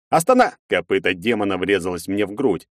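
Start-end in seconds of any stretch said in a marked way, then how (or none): 0.1-0.6 cut-off desperate shout; yelling. Acted. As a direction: yell mid-word. Hero yells at demon.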